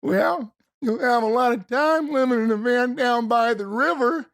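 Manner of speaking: high shouted building rant; Farley's angry rant. Retold or imitated. Farley yelling at the girl about her van by the river. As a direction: build to an exasperated Farley yell. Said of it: "getting pissed off".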